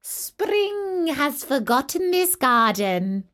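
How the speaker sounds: high, slow gleeful frost voices; cold spirits crowing over the garden; snow and frost. Acted. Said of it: "they cried".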